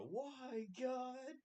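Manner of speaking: very high, loud, drawn-out mock yell; hammed-up despair, pleading with the heavens; Joey's anguished wail. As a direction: imitate a sitcom character wailing at God. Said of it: "he just looks back up at the camera".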